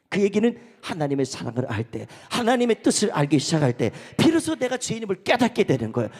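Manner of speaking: loud, emphatic proclamation; full-voiced sermon delivery; forceful preaching. Real, not acted. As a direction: drive the point home from the pulpit.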